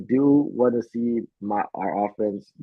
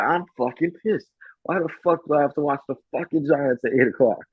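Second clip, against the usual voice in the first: higher, pissed-off ranting; higher, mocking angry tirade; mock furious rant; 7 semitones higher than usual. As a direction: play the host blowing up on air. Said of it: "'cause he is spazzing".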